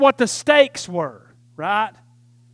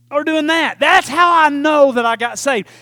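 normal talking voice

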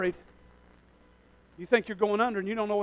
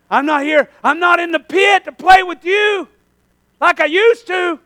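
raised, defiant preacher voice; forceful confrontation; 8 semitones higher, 11 dB louder than usual. Acted. Benaiah to the lion in the pit, loud defiance. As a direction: stand your ground, loudly.

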